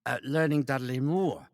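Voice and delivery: high, slow English comic impression; Dudley Moore. Telling how he learned the voice, high comic impersonation. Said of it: "You gotta learn Dudley Moore's voice".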